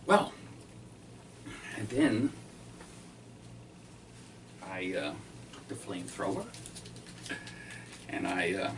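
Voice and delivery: usual speaking voice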